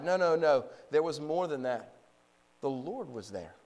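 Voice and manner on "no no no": ordinary delivery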